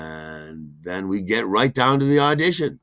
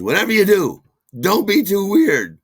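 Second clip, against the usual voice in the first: high, loud urgent warning; shrill the warning at him; high, loud Suzanne; 12 semitones higher, 11 dB louder than usual. Retold or imitated. Suzanne warns the actor a second time, high and loud. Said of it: "She says".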